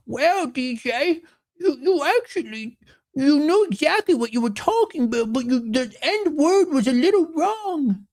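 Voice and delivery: mocking the wife who corrects his wording; mock nagging wife. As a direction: parody the wife correcting him word by word. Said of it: "y'all sound like my wife".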